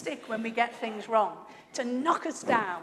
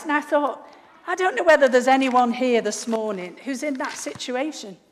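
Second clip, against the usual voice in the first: ordinary talk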